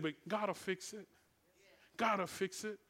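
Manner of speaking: very soft fatherly reassurance; soft and compassionate. Retold or imitated. Father assuring his son God will help, soft and compassionate. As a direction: reassure quietly, barely raising the voice. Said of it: "he's become soft and compassionate".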